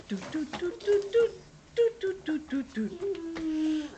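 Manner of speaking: high, light staccato doo-doos; tiptoe noises. Acted. Reader showing kids sneaking behind the house, playful tiptoeing.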